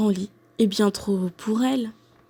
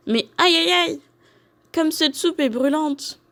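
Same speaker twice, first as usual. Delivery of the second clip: higher, yelping reaction to scalding soup; high-pitched ouch of dismay; pained exclamation; 7 semitones higher than usual. A narrator's reading. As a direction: exclaim as if the soup burns your tongue. Tastes burning hot soup.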